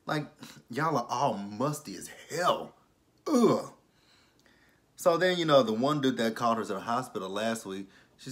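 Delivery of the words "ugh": disgusted ugh. Real, not acted. Reacting to musty goons.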